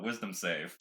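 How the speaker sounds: ordinary delivery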